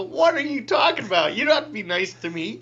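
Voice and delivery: high and loud, pleading for a no; frantic protest. Retold or imitated. Pat to the vocation director, high loud pleading. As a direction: beg to be rejected, voice up high.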